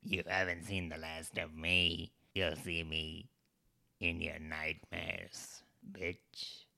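low, slow villain threat; menacing villain. Pretend. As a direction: growl it like a movie villain.

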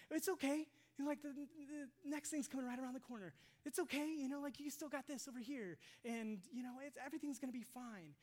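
high-pitched mock comforting; glib reassurance. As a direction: imitate someone breezily brushing grief aside. Mimics glib comforter of the grieving.

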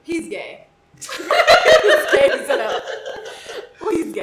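laughing loudly through it; cracking up mid-sentence. Real, not acted. Laughing verdict.

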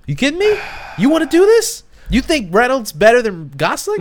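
high, loud playful challenge; mock outrage. Real, not acted. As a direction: rise up in jokey disbelief. Defending Gosling, playful challenge.

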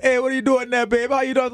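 put-on a Trinidad accent that just comes on when needed. A Trinidadian man greets a young woman at the bar, in a Trinidad accent. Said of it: "how you just turned on that Trinidad accent".

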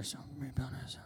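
quick whispered aside. Acted. Servant muttering to himself about the altar, whispered. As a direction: drop to a whisper.

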